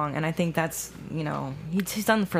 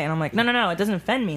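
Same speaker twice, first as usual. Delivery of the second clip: ordinary talk, nothing marked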